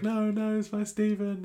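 high, thin wail of a distraught mother; mock-distraught, high-pitched protest; Audrey. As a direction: wail it up high without raising volume. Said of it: "Audrey comes over and she's like".

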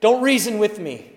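angry person warning off reason; angry retort. Retold or imitated.

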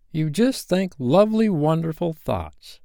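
put-on high boy voice, stretching out the end; Peter-like childlike lilt; airy boy explaining. A narrator's reading. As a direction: explain dreamily, pitched high.

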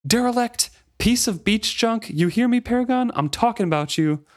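high-pitched taunting call; angry shout. A narrator's reading. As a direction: raise the voice and hurl it out as an insult. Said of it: "he raised his voice in a shout".

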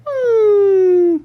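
high-pitched creature hum; tiny, high apologetic whimper; apologetic little coo. Acted. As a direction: coo a wordless sorry. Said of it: "trying to say, "I'm sorry".

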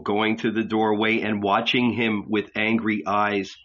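usual speaking voice

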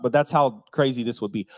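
ordinary delivery

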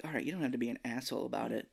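soft, fast mutter under the breath; fast muttered complaint; muttered aside. Retold or imitated. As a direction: grumble it quickly to yourself. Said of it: "I'm just like".

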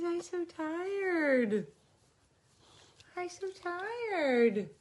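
high, slow, drowsy dog voice; voicing the dog. Voicing Booby the tired dog, high slow whine. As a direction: moan it like a worn-out pup.

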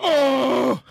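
high, very loud drawn-out "oh"; loud hype whoop. Acted. Co-host capping his own introduction, loud whoop. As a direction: let out a big showboating holler.

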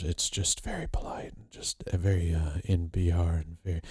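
soft unvoiced whisper imitating a polite NPR guest; ASMR whisper. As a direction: breathe it like a hushed ASMR host. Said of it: "A S M R'd the hell out of".

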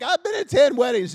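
high, loud, exasperated complaint; bitter friend voice. Retold or imitated. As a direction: whine it like a bitter bridesmaid. Imitating bitter bridesmaid friend.

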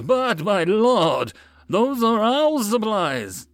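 high, slow, drawn-out plea; pleading protest. Acted. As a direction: protest in dismay to a lord. Dismayed merchants protest the seizure.

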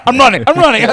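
high, loud, urgent repeats; mock panicked cry. Reading friend's escape texts aloud, mock panic. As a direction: call it out like someone bolting.

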